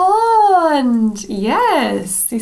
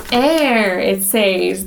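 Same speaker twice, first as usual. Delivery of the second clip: plain reading voice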